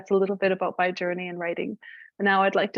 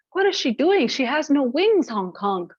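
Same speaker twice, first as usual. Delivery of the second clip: high, louder squawking goose cry; alarmed goose; 6 semitones higher, 4 dB louder than usual. Acted. Goose spotting a woman falling, alarmed high call. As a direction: sound the alarm as a startled goose.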